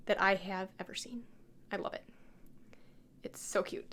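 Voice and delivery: soft unvoiced coo over something tiny; doting whisper. Real, not acted. To viewers about the cutest little amethyst, a doting whisper. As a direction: whisper it like it's too cute to say aloud.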